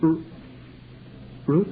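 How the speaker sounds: nervous stammer on the name; stuttering. Acted. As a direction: trip over the first sound. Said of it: "I stuttered like I always do when I get confused".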